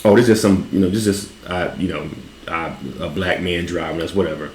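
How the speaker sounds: low, quiet, offhand brush-off voice; dismissive. Retold or imitated.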